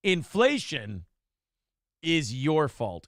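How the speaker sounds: slow, measured reading of "inflation is your fault" at normal level; deliberate title read. Shown as a demonstration. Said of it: "first, less drawn-out read of title".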